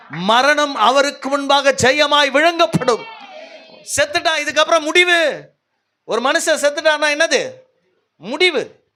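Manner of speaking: high, driving sermon delivery; impassioned preaching. Real, not acted. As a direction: build one long urgent appeal to the congregation. (உணர்ச்சி பொங்கும் உரத்த பிரசங்கம்)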